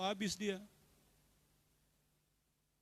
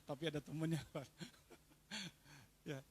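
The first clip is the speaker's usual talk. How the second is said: ordinary talk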